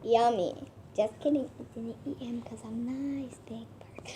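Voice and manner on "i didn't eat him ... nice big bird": quick unvoiced whisper; whispered aside. Whispering to the big bird that she was nice. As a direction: hush it fast like a secret.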